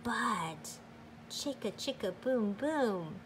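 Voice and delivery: ordinary delivery, nothing marked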